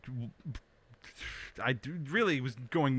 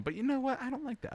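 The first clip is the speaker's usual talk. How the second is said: mock petulant; 13 semitones higher, faster than usual. Telling the audience he refuses phone streaming, mock petulant. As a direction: whine it like a fussy child.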